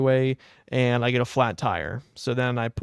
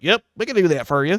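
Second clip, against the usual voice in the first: put-on old tire shop guy; 5 semitones higher, 5 dB louder, faster than usual. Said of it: "He's like".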